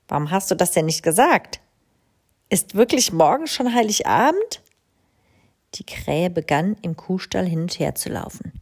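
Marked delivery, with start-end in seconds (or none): 2.5-4.6 higher, startled question; surprised crow. A narrator's reading. Crow learning Christmas is tomorrow, startled. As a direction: gasp at the news.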